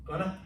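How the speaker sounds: "Gonna" mumbled for are you going to; slurred shorthand. Retold or imitated. Captain asking a colleague if he's going, slurred shorthand. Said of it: "we can shorten things".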